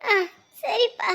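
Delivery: high tearful assent; tearful crying. Acted. Mother agreeing with departing son, crying. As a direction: squeeze it out through tears. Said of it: "நீங்க அலறதுனால அப்ப அவரும் அழுகுறாரு".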